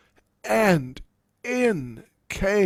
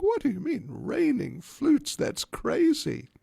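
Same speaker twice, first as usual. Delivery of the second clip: shocked disbelief; 13 semitones higher than usual. Acted. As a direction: squeak out disbelief. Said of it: "Jatila was in shock".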